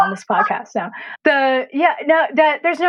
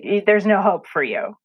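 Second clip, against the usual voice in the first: ordinary talk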